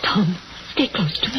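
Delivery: tearful, terrified whisper; frightened whisper. Acted. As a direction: whisper it close, shaking with fear. Terrified wife after the gunshot.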